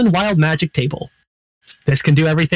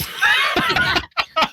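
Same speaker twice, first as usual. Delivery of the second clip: high squealing laugh; laugh-shriek at sour taste; pained shrieking laugh. Real, not acted.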